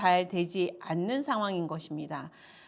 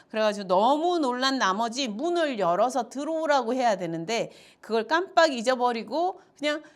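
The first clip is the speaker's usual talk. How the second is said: ordinary talk, nothing marked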